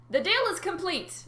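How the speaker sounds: high, loud triumphant gloat; mocking high gloat. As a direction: crow it over his victory. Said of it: "Nereus gloated".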